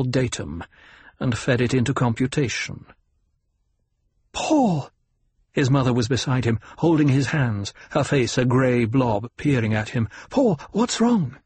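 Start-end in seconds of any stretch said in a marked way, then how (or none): 4.3-4.9 high, worried mother's cry; alarmed call. A narrator's reading. 10.3-11.4 high, slow, anxious question; worried mother. A narrator's reading. Mother anxious for her son.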